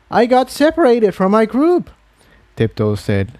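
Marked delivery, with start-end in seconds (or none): 0.1-1.9 high mare voice, defending herself; defensive young mare. Acted. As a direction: push back, high and a bit defensive.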